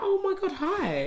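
very high-pitched acted hello; delighted greeting. People respond to a smile and hello, high and delighted. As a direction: imitate someone thrilled to be greeted, voice way up.

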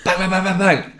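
rapid high mock gunfire; cartoon shooting noises; imitated gunfire. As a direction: fire off the bangs like a gun. Imitated gunfire ends the joke.

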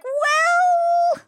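very high, long stretched "well"; mock wavering. Hackers reconsidering Kate's request, high drawn-out wavering. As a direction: draw it out high as if being won over. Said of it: "And they're like".

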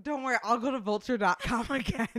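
normal talking voice

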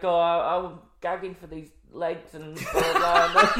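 put-on laughing, pining girly voice; mock mermaid. Mocking the Little Mermaid's longing, laughing. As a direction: giggle through a dreamy wish. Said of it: "It's like".

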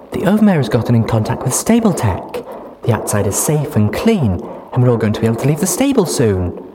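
put-on high, loud, giddy young mare. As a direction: chatter happily about the future. Duct Tape excited.

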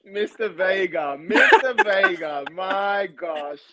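slow, drawn out through laughter; cracking up at the message; real laughter breaking through the name; laughing. Real, not acted. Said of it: "He cracked me up".

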